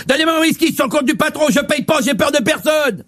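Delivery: swagger like a little bully; mock tough guy. Skinny tough guy demands.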